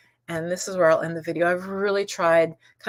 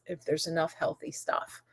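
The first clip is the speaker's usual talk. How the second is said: whisper; 3 semitones lower, 11 dB quieter than usual. Real, not acted. Unvoiced soft aside.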